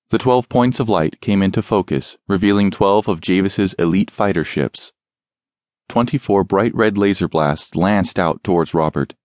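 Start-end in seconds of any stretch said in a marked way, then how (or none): none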